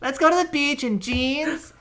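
put-on high, valley-girl style woman's voice.

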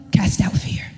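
a low hushed whisper on the verse's end. Acted. Pastor's wife reading First John to the church, whispered. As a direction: drop to a reverent whisper.

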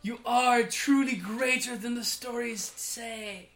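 fanboy awe. Acted. Fanboy addressing the great land vetter, gushing in awe. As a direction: gush like an awestruck fanboy. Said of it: "fanboy-ish, you know? And he's like".

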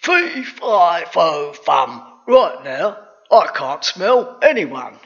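loud, drawn-out ogre chant; storybook giant voice; giant muttering aloud. A narrator's reading. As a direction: sniff the air and rumble it out. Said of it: "muttering out loud".

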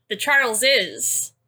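slow, deliberate "Charleses" with every syllable laid out; correcting the plural. Shown as a demonstration. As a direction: spell out "the Charleses" slowly to correct him. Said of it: "I think you mean the".

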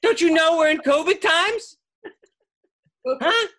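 hammed-up yell at the caller; retold outburst. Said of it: "And I was like".

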